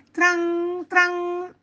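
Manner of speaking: high, ringing, drawn-out clang; tram imitation. Boy hearing the tram, high clanging imitation. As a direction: let each tran ring on. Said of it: "sentendo il tram".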